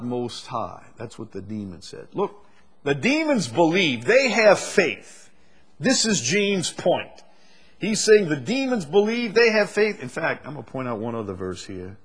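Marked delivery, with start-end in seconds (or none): 2.1-10.0 preaching at full force. Acted. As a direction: proclaim it to the congregation. Preacher peaks on key point.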